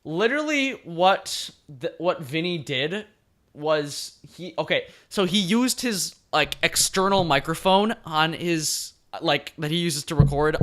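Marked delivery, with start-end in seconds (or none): none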